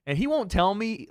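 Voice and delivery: ordinary talk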